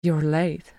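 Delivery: plain reading voice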